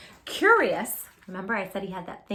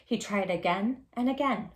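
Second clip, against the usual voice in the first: ordinary delivery, nothing marked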